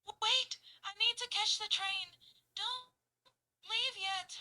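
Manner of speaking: high, loud, panting pleas; heavily panting. Shown as a demonstration. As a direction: pant between words, winded. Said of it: "very heavily breathing and panting".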